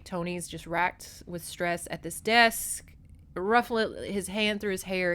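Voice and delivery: usual speaking voice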